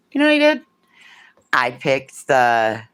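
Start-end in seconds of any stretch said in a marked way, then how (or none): 0.1-0.6 excited squeal. Real, not acted.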